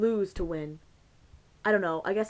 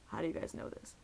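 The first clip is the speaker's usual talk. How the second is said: hushed quote; 3 semitones lower, 8 dB quieter than usual. Retold or imitated. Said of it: "I'm immediately, like, going".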